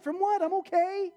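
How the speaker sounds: high-pitched, defensive bewilderment; baffled protest. Acted. Stranger answering a pushy evangelist, baffled mock protest. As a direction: protest in exaggerated confusion.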